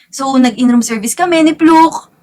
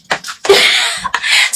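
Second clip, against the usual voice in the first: airy squeaky laugh; high breathy giggle; 4 dB quieter than usual. Real, not acted. Laughing at her own cramped-room skit, high airy giggle.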